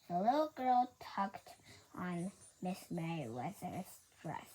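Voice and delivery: usual speaking voice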